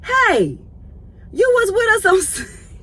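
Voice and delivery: put-on high, loud old lady greeting; imitating the elderly lady. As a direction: call out like a sweet elderly churchgoer. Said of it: "she was like".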